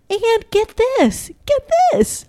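high-pitched, eager "get this"; excited reveal. Real, not acted. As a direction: tease big news in a high voice. Excitedly sets up big reveal.

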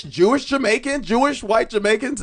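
ordinary delivery

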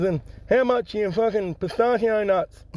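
high-pitched bloke with a cleft palate. Acted. Joke teller voicing the customer's greeting, cleft palate voice. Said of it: "This bloke got a cleft palate and a hair lip".

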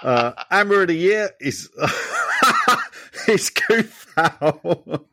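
real laughing. Real, not acted. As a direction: try to read through helpless laughter. Cracks up while reading the vote.

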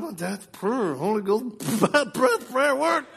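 high-pitched amazed report; acted shock. Husband tells wife dad's odd prayer worked, astonished. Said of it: "I was all shocked".

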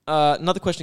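ordinary delivery

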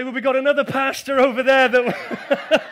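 loud, laughing joke reply. Acted. Pastor jokes to the accusers, laughing loudly. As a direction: laugh through the brush-off. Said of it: "I'd say".

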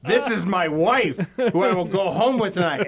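a hint of a wary husband; imitating the singer. Singer voiced in story.

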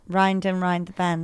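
softly imitated Northern Irish accent. Shown as a demonstration. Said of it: "in a Northern Irish accent".

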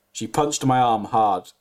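usual speaking voice

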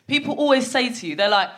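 ordinary delivery

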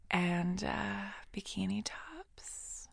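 slow creepy whisper; crazy voice. Shown as a demonstration. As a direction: breathe it out like a madwoman. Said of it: "keep going like that".

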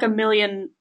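ordinary talk, nothing marked

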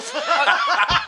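loud, high cackle; burst of laughter. Real, not acted. Storyteller cracking up about being recognised in Vegas, laughing. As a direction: crack up helplessly.

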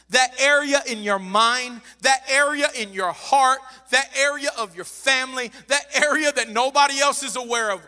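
loud, insistent building repetition; full-force preaching. Real, not acted. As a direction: drive each phrase home to the congregation.

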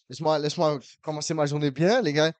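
usual speaking voice

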